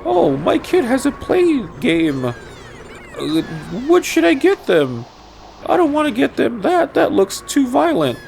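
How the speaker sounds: high-pitched, fretful parent voice; shopping parent. Acted. Parent wondering what game to get the kid, high put-on voice. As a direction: put on a squeaky parent fretting over a purchase. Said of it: "kids are at Walmart or Circuit City".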